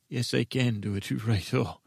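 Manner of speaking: normal talking voice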